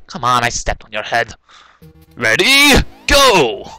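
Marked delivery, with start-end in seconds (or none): 2.2-3.7 high, raised ready-go call; calling the start. Real, not acted. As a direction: shout yourself into the next attempt.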